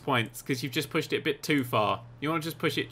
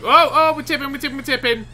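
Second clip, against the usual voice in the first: high, urgent warning; playful panic, pitched up; excited alarm; 8 semitones higher, 5 dB louder than usual. Real, not acted. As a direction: start loud, then gabble the warning. Bus starts tipping over.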